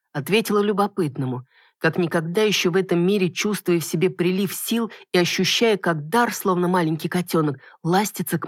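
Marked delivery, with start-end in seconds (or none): none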